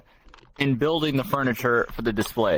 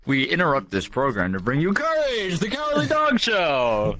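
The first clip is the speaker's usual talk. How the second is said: put-on old radio announcer; 5 semitones higher than usual. Quoting the Courage cartoon intro.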